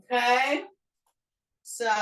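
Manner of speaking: ordinary delivery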